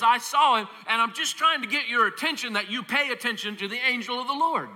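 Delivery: lightly voiced donkey setting him straight; patient talking donkey. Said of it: "the donkey says".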